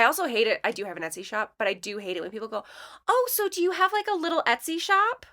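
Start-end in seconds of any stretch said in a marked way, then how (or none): 3.1-5.2 high, sing-song mock sweetness; condescending tone. Mocking people who ask about her shop, condescending. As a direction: coo it upward, making it sound tiny. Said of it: "not use that tone with me".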